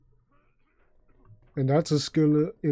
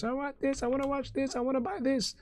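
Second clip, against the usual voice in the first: high, softer mock kid scream; imitating demanding kids; 12 semitones higher, 5 dB quieter than usual. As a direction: whine it high like a child begging parents. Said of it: "screaming to their parents".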